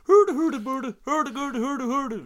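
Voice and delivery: high, loud mock Swedish babble; Swedish Chef gibberish. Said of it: "he's like".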